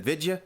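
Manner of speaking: "Vidya" for video, squashed to two syllables; mimicked old-time pronunciation. Host mocks the coach's word for video, mimicked pronunciation. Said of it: "He said, "Vidya," ... what year is that accent".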